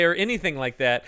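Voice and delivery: ordinary talk, nothing marked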